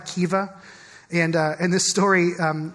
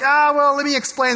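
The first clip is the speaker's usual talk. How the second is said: awkward excuse; 5 semitones higher, 4 dB louder than usual. Retold or imitated. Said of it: "you're always like".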